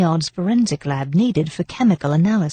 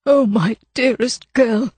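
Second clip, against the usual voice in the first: slow, higher, voice breaking with grief; anguished; 6 semitones higher, slower than usual. A narrator's reading. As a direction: grief cracking through each word. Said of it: "His anguished voice broke".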